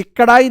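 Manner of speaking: कढ़ाई mispronounced as कड़ाई; unaspirated कढ़ाई. Shown as a demonstration. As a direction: drop the puff of breath in कढ़ाई. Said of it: "नहीं है". (कढ़ाई को बिना महाप्राण के कड़ाई बोलें)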